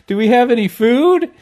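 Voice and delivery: cheerful clueless office voices; mock coworkers. Imitating coworkers at the potluck all bringing drinks, high and chirpy. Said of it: "it's like".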